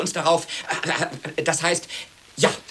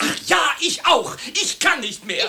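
eager outburst; 8 semitones higher than usual. Acted. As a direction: blurt it out with eager relief. Spouses racing toward divorce.

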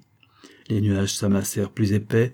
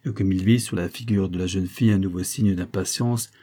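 ordinary delivery

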